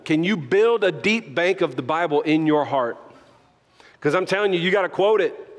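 ordinary talk

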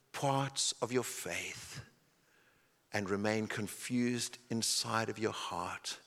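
hushed sincere sermon voice; quiet, earnest preaching. Acted. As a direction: draw them in close and gently. Quiet intimate sermon section.

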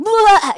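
sudden high, loud blurt; mock explosion of kid excitement; imitated child outburst. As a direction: burst out in a wild nonsense yell. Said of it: "would have these outbursts".

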